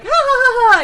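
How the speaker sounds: high, loud demo cackle of a laugh; loud mock ha ha laugh. Shown as a demonstration. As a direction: show what real laughing sounds like. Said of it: "Laughing is like".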